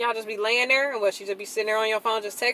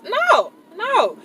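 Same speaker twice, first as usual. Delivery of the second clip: firm refusal; 12 semitones higher, 4 dB louder than usual. Real, not acted. Refusing firmly.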